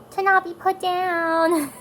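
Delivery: put-on high, loud, drawn-out kid voice; five-year-old's voice. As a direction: imitate a small child answering slowly. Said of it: "And she's like".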